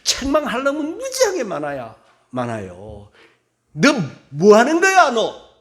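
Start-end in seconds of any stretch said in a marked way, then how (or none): 3.7-5.5 loud, high, harsh berating of a sleeping prophet; scolding yell. Acted. Preacher voicing someone scolding the exhausted Elijah, angry yell. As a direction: bark at him like a furious scold.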